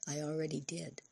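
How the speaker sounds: very soft, small confession; almost inaudible. A narrator's reading. Girl admits she already made the call, almost inaudibly. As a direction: let it drop almost to nothing. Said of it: "said almost inaudibly".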